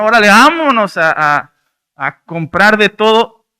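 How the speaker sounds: loud, high call to go shopping; rally everyone off on a spree; eager spending spree. Acted.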